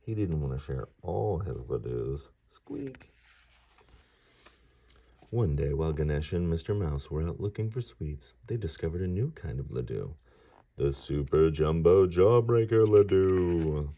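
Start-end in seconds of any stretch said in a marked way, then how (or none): 2.5-3.1 tiny squeak, much higher and softer; mouse squeak. Acted. Storyteller voicing the mouse, a high squeak. 10.8-13.9 slow, louder, big reveal; grand announcement. A narrator's reading. Reading to children, unveiling the giant sweet grandly.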